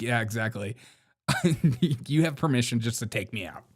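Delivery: normal talking voice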